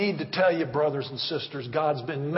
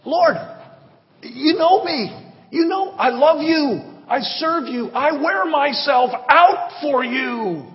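higher, louder aggrieved protest; whining complaint; 10 semitones higher, 11 dB louder than usual. Pretend. Pastor complaining to the Lord, higher whining. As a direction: whine at God like an overworked servant. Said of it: "pastor's whining reply to God".

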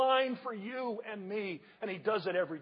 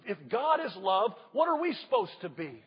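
normal talking voice

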